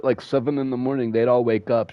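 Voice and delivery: normal talking voice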